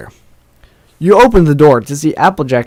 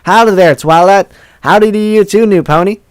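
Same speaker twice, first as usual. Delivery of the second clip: loud, high-pitched folksy hail; cowgirl pony greeting; 7 semitones higher, 4 dB louder than usual. Acted. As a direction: greet them heartily as a country pony.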